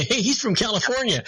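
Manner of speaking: light mock-excitement, like a thrilled European; excited exclamation.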